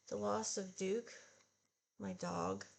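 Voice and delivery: usual speaking voice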